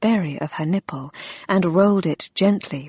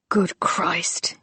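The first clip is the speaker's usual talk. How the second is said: gasped whisper. Acted. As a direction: breathe it out in a gasp. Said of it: "he gasped".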